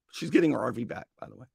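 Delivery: normal talking voice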